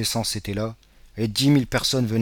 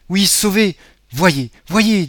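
put-on high, loud, eager girl; urging him to look, overjoyed; excited insistence; 9 semitones higher, 8 dB louder, much slower than usual. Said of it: "dit la jeune fille". (jeune fille pressante et ravie)

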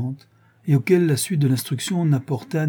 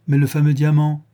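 louder, higher urgent question; exclaiming; 4 semitones higher, 6 dB louder than usual. A narrator's reading. Inspector bringing up the famous diamond, exclaiming loudly. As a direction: cry it out as an objection. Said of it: "s'écria Ganimard".

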